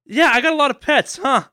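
high, loud teasing voice; mock incredulous friend. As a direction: needle him about all the pets. Mocking someone's reaction to pets.

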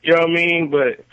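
ordinary delivery

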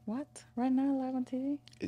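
startled, voice jumps high; surprised protest, pitch way up; caught off guard. Real, not acted. Asked to perform on the spot.

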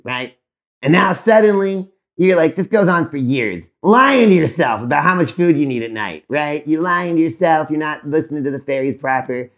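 ordinary talk

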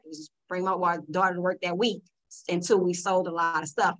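usual speaking voice

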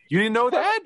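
high-pitched incredulous question; real surprise. Real, not acted. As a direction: ask it amazed, voice leaping up. Host amazed that co-host hadn't heard.

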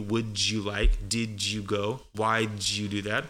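"Would you", "Did you", "Why did you" each joined with a J sound; linked D and Y. Shown as a demonstration. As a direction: blend "did you" into "didju". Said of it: "that D from would and the Y from you".

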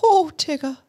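a hint of Pooh's scratchy voice; Winnie the Pooh voice. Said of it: "he has that scratchy voice".

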